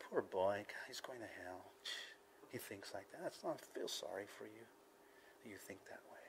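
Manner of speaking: very soft, low, sinking to a near whisper; pitying. Acted. Believer being yelled at by unbeliever, hushed pity. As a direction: murmur pity under your breath. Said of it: "Have pity on others".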